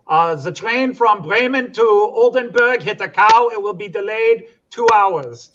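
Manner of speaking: put-on station announcer in Germany reports the cow, German accent. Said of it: "I don't know why I do a German accent".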